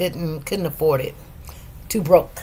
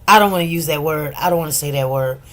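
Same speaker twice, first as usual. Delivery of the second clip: low, quick, understated refusal; imitated attorney; 8 semitones lower, 3 dB quieter, faster than usual. Attorney declining to repeat a word, low understated imitation. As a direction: drop the pitch and decline briskly. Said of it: "he'll be like".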